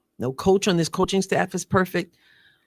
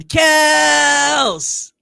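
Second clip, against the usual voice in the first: hyped shout; 10 semitones higher, 8 dB louder than usual. Pretend. Reading a fan hype post.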